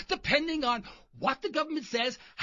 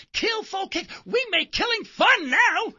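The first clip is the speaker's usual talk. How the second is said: mock excited pitch; 8 semitones higher, 8 dB louder, slower than usual. Mock enthusiastic sales pitch.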